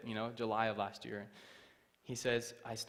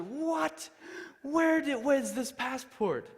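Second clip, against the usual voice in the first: loud, high, drawn-out disbelief; shocked officer; 7 dB louder, much slower than usual. Retold or imitated. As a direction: shout it like a stunned official. Officer shocked at seeing hidden passport.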